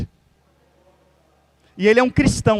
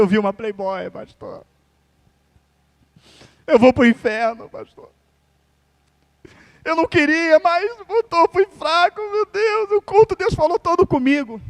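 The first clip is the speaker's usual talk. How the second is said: tearful confession; 7 semitones higher than usual. Retold or imitated. Retells tearful confession to pastor.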